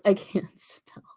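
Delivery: breathless laughing whisper. Real, not acted. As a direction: wheeze it out through laughter. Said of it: "Oh my gosh, that's funny".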